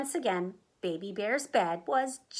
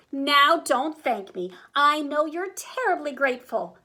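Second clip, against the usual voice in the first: higher, louder, breezy insistence; self-satisfied gushing; 5 semitones higher, 5 dB louder than usual. A narrator's reading. Goldie waves off thanks from the bears, gushing. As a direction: race on over any protest.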